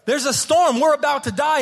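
panicked disciples. Retold or imitated. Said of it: "they wake him up, like".

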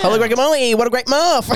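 high squeaky voice; squeaky kid voice. Retold or imitated. Friend doing the teen worker, high squeaky voice. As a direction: chirp it like an eager boy. Continuing the Elliot impression.

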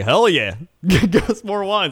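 loud, high, eager cheering; mock cheer. Friends cheering at the waiter's wine offer, excited. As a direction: hype it up like being offered free wine.

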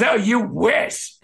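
high, drawn-out mock scorn; retold scornful comeback. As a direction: imitate a haughty put-down, stretched out slowly.

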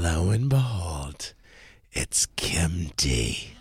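whispered reveal, slow and hushed; dramatic whisper. Real, not acted. Host revealing the surprise guest to listeners, whispered. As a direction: breathe the name like a secret.

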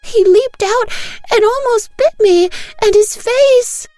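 put-on wailing child. Daughter wailing to her mother about the attack, very high. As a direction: wail it like a little girl in terror. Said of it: "Bunny wails now".

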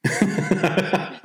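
high bursting laugh; burst of laughter. Real, not acted. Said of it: "I think that's hilarious".

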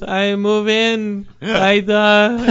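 high, very slow, odd voice; mock Tommy Wiseau. Tommy Wiseau fumbling an answer to the worker, slow and odd. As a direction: stumble like Tommy. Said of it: "And he goes".

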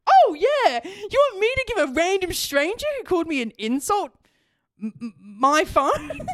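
incredulous sarcasm. Retold or imitated. Sarcastic reply to dad's request.

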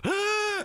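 sharp high-pitched gasp; mock gasp. Acted reaction in retold story.

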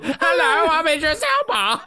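very high-pitched silly impression; Idris Elba. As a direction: copy the bad impression, squeaked through laughter. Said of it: "That's our impression of him too".

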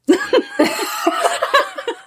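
high-pitched cracking up; helpless laughter. Real, not acted. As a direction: burst out in high giggles. Cracks up at misunderstanding.

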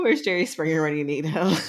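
normal talking voice

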